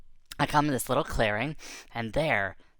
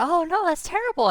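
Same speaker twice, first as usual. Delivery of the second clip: sympathetic; 5 dB louder than usual. Retold or imitated. Sympathizing with rabbit.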